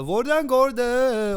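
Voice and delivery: Norwegian in a high, loud mock Indian accent. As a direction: stretch it out in an exaggerated Indian accent. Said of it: "I gave an Indian".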